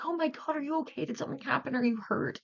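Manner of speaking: high, alarmed concerned voice; worried staff member. Retold or imitated. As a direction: sound startled and worried for her. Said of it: "And she was like".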